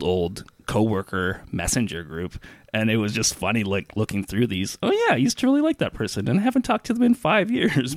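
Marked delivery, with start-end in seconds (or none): 4.8-8.0 fast, much higher, breezy recollection; mock nostalgic thought. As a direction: voice the passing thought lightly. Recalls thinking while scrolling chat.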